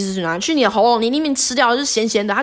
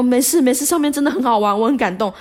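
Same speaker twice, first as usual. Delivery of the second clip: tearful reassurance; 7 semitones higher, 4 dB louder than usual. Retold or imitated. 她边哭边安慰别人.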